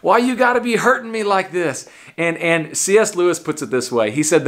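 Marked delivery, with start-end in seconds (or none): 0.0-1.9 higher, pleading, hurt complaint to God; whiny complaint. Pretend. Believers whining to Jesus about painful grace, higher and hurt. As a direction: whine it like a wounded believer.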